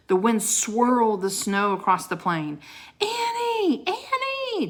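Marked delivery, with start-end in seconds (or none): 3.0-4.7 calling out. Acted. Jack calling for his lost sister in the storm, high shout. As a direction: shout her name across the snow. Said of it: "Jack called".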